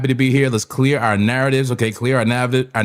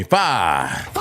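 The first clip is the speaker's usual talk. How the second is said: laughing; 4 dB quieter than usual. Real, not acted. Laughing at number five.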